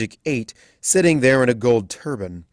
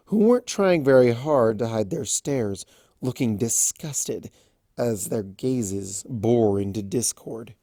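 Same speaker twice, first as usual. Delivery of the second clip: usual speaking voice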